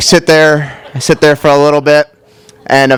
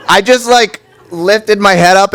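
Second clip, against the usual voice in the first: ordinary talk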